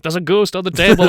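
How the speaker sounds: hammed-up panic at a sudden sight; freaking out. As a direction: cry out like a spooked, freaked-out king. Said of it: "started freaking out 'cause he was like".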